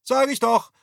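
very high, loud call; ringing from the kitchen. Acted. Mother's voice rings out from the kitchen, high and loud. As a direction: let it ring out from the next room. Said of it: "schallte es aus der Küche".

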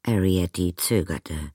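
usual speaking voice

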